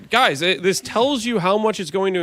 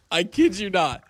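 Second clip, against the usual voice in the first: normal talking voice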